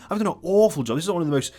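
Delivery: rising to a high, loud outburst; self-mocking rant. Real, not acted. Mocking his own video.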